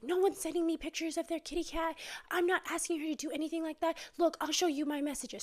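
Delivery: mock rising upset, insisting he's innocent; upset denial. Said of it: "getting more and more upset".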